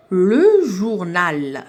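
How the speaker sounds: drawn out, very slow, each syllable joined; slow syllable blending. Shown as a demonstration. Teacher showing pupils how syllables link, very slow. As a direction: stretch it slowly so pupils hear the syllables join. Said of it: "ربطت بيناتهم".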